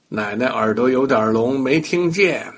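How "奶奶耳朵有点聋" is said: plain reading voice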